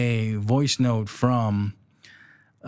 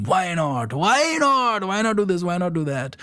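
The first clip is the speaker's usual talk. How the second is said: put-on Indian accent; 7 semitones higher than usual. Sibling showing off the family motto, playful Indian accent. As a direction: exclaim it in an Indian accent, pitched up. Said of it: "we say it in an Indian accent".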